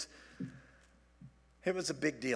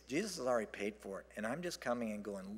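ordinary talk, nothing marked

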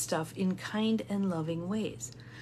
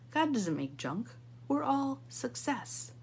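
ordinary talk

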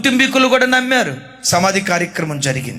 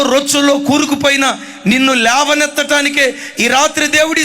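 fiery preaching; 4 semitones higher than usual. Acted. Preacher warning the listening young people, fervent. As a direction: exhort them, rising in force.

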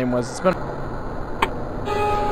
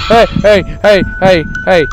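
jumping in fright, calling out; scared horror game streamer; 4 semitones higher than usual. Real, not acted.

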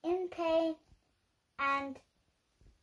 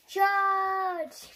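loud battle cry; 4 semitones higher, 7 dB louder than usual. Acted. Wolf charging at Tony.

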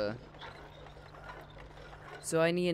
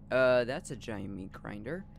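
ordinary delivery

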